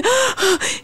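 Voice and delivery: high gasp imitating a panic attack; sharp, high intake of breath. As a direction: act out gasping in panic. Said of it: "sentí como ataque de pánico".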